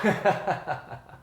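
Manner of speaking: high bursting laughter; giddy laughter with the glasses on; giggling fit. Real, not acted. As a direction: laugh helplessly at yourself. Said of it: "I can't stop laughing".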